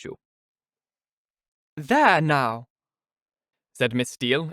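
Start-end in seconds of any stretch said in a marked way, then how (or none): 1.8-2.7 high, loud coy simper; affected simper. Acted. Miss Steele coyly answers Mrs Jennings, simpering. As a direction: simper with false modesty. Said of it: "affectedly simpering".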